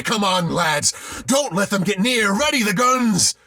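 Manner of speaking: high, loud shouting; rallying shout. A narrator's reading. Sailor rallying the lads to the guns, shouting. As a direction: rouse the lads.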